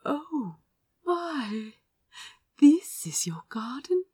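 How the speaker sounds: awed near-whisper, voice rising; soft with awe. Acted. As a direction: breathe it out in hushed wonder. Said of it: "Azra's voice was soft with awe".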